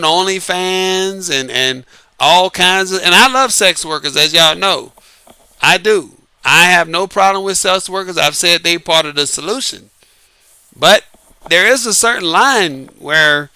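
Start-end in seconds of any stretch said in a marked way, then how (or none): none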